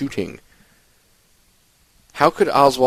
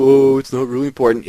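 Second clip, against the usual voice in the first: dismissive mock voice, higher and louder; mocking the doubters; 4 semitones higher, 6 dB louder than usual. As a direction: sneer it like the people who shrug it off. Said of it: "I know a lot of people".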